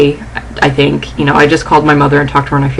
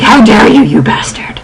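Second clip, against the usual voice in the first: angry outburst; 6 semitones higher than usual. Acted. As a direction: burst out in outrage, then drop on the insult. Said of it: "I'm so angry".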